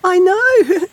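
very high, loud mouse giggle; giggling. A narrator's reading. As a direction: squeal it with a giggle. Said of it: "giggled Mouse".

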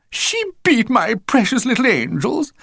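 high, shocked society mother; posh mother voice. A narrator's reading. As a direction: gasp it out like a pampered mum. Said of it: "Missus Frightfully Busy looked up".

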